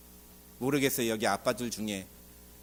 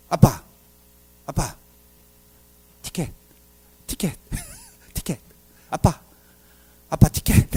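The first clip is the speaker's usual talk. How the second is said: put-on nagging child; 3 semitones lower than usual. Child nagging father for ticket.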